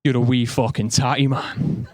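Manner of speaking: broad Glaswegian growl, laughing through it; mock Glaswegian insult; Glaswegian accent. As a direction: do the angry Glasgow local from the pub, half laughing. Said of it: "he goes up to a mate and goes".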